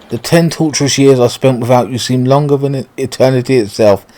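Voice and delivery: ordinary delivery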